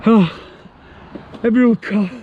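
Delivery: heavy breathing after hauling; puffing and out of breath. Real, not acted. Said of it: "complaining about heavy breathing".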